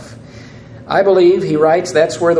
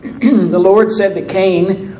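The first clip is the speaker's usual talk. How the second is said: ordinary delivery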